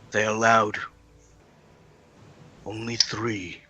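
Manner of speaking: hushed Fey Lord, halting between phrases. Acted. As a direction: answer softly, leaving long pauses. Game master voices Fey Lord.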